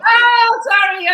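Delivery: high, loud burst of 'oh, sorry'; startled apology. Real, not acted. Guest to host after an accent remark, startled loud apology.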